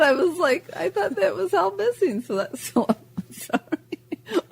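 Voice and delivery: giggling through the words; can't stop giggling; laughing. Real, not acted.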